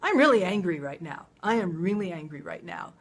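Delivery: firm, loud statement of anger; loud, emphatic anger without meanness; demonstrated anger. Shown as a demonstration. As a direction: let the anger out in a firm, controlled burst. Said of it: "You can release your anger by saying".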